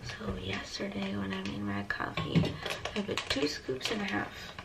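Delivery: hushed whisper so others sleep. Real, not acted. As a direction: whisper softly, people asleep nearby. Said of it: "I'm whispering 'cause people are sleeping".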